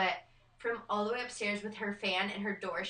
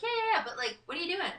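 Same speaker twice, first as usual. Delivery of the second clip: high-pitched pestering, acted out; mock-impatient high insistence; playful brush-off; 9 semitones higher, faster than usual. Brushing off mother in retold call.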